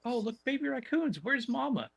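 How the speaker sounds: high, sweet cooing voice; cooing over baby raccoons. Retold or imitated. Night guard spotting baby raccoons in a tree, high sweet coo. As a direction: coo at the babies like they're cute. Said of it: "I was like".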